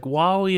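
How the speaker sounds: "Wario." said as "Wawio" with the R turned to a W; a small kid who can't say R yet saying "Wario."; child's lisped Wario. Retold or imitated. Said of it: "had a slight speech impediment".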